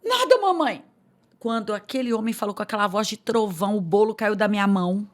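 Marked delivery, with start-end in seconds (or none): 0.0-0.8 put-on high small child's voice; little girl. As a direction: play the caught daughter, innocent.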